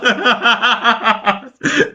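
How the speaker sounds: big burst of laughter; loud laugh. Real, not acted.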